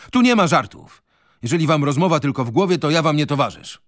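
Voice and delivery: Michajłow refusing to back down, loud; stern outburst. A narrator's reading. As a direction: cut them off hard. Michajłow confronts the group.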